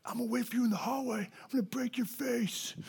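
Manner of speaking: hushed, strained bully threat; soft mock menace from a bully; acted threat. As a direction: threaten under your breath, pressing each word. Bully threatens him in story.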